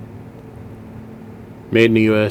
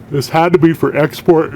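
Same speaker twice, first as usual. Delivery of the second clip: words stretched by a yawn, higher and louder; yawning mid-sentence; through a yawn; 7 semitones higher, 4 dB louder than usual. Real, not acted. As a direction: talk while yawning. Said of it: "I don't know why I'm yawning".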